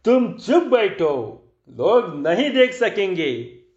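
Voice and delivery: high, drawn-out mild rebuke; irritated hushing. A narrator's reading. Magician to heckling Babu, irritated hushing. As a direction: shut him up with slow, stretched words.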